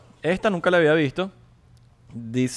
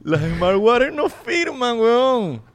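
high, slow, drawn-out wail; dismayed wail; 8 semitones higher, much slower than usual. Acted. Host lamenting the failed brand to friend, high wail. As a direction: wail it high, stretching every word.

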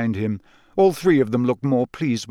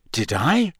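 puzzled, rising question; mildly bewildered query; perplexed; 4 semitones higher than usual. A narrator's reading. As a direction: ask it baffled, pitch lifting.

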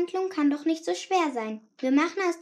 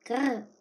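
annoyed growl; 3 semitones lower than usual. Acted. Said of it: "frustrated growl".

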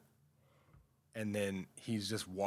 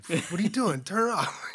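sharp scolding, much higher and louder; 9 semitones higher, 7 dB louder than usual. Retold or imitated. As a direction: snap at him to turn it off.

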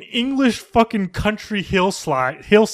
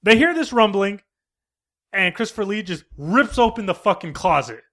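normal talking voice

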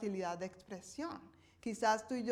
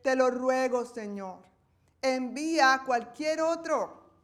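louder, earnest begging; pleading Moses; 7 dB louder than usual. Acted. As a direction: Moses imploring to be spared. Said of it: "Moisés suplicó de nuevo".

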